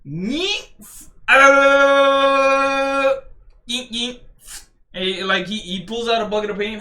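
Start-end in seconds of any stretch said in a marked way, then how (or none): 0.1-4.2 high playful yeet sounds; mock swing noises. Acting out Luffy's swing for viewers, high playful yeets. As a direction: toss off the sound effects.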